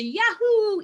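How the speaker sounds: high, loud mock whoop; a hammed-up yahoo of joy; excited cheer. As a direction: whoop with sudden delight. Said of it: "go from like ... to".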